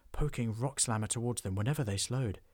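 ordinary delivery

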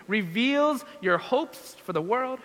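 ordinary talk, nothing marked

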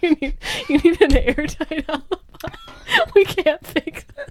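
high-pitched, cracking up while playing the boss; laughing mock quote. Skinner advising his married agents on an alibi, laughing mock quote. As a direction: voice a boss's advice through giggles.

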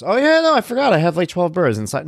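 sarcastic high mock. Mocking the phone guy with a fake yes, high and hammed-up. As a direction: play it up as an absurd yes. Mock answer to the birds question.

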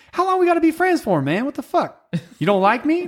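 high, squeaky mock hurt friend; mock offended coworker. Coworker teasing me, high mock-hurt voice. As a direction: squeak it out like a wounded buddy.

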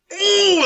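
high, drawn-out mock wince; pained "ooh". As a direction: cry "ooh" as if it stung. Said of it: "that hurts".

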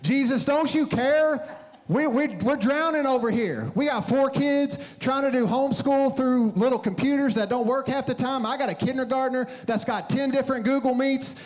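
high, frantic rant at God; freaking out. Retold or imitated. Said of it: "I'm freaking out".

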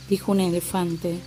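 ordinary delivery, nothing marked

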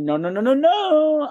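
"no" rising high at the end; scolding professor, pitch climbing; mocking the professor. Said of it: "he went".

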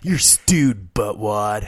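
put-on snotty teen sneer; nineties teen bully. Trading insults, cohost sneers like a nineties teen bully. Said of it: "that's a good nineties insult".

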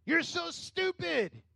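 high, slow, drawn-out mock insult; mock scolding God. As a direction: sarcastically play the harsh God people imagine. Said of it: "And what did God do?".

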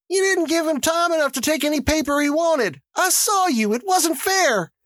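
high-pitched protest, no real shout. A narrator's reading. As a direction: voice an indignant woman calling out high. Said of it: "Tessie Hutchinson shouted to Mister Summers".

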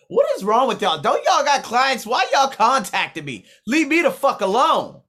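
exasperated shout. Retold or imitated. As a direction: blast them away in frustration. Quoting himself venting at gym.

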